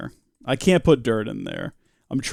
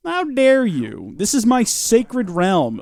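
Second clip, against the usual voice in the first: slow, high mock indignation at the intruder; hammed-up offended ruler of his realm; mock outrage; 7 semitones higher, slower than usual. Gamer scolding a sneaking mob, mock offended.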